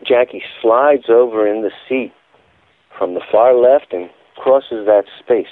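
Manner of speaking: ordinary talk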